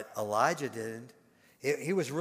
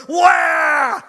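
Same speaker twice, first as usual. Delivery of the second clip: high loud hammed-up wail; imitated wail of grandpa; 17 dB louder than usual. Grandpa mocking his own panicked wailing. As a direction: cry out along with the kid. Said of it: "what is his Baba doing?".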